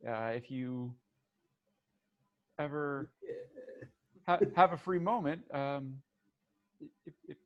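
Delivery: very soft, halting thanks; hushed, faltering guest voice; shy offer. Acted. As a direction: hesitantly hand over an invitation, voice small. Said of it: "and she says".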